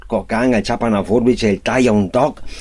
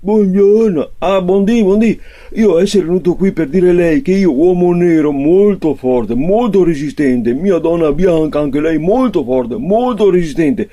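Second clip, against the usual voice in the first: high, insistent foreign customer; broken Italian customer; 4 semitones higher than usual. Acted. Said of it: "il nero torna in farmacia".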